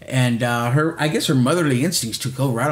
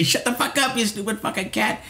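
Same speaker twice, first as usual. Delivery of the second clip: mock yell at cat; 7 semitones higher, 3 dB quieter than usual. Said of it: "I would always say".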